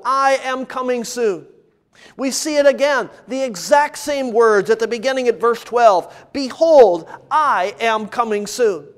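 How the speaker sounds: usual speaking voice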